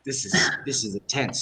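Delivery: hushed aside. Retold or imitated. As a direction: say it under your breath. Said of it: "I'm like".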